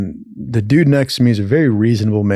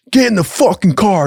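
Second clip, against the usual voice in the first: higher-pitched imitation of a cop's order; 7 semitones higher than usual. Cop orders drunk man into the car, mock commanding. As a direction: play a cop ordering someone about.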